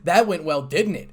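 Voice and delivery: loud, sarcastic retort, rising in pitch. A narrator's reading. Issei recalling the meeting to Azazel, sarcastically. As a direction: build to a pointed sarcastic jab. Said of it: "asked Issei sarcastically".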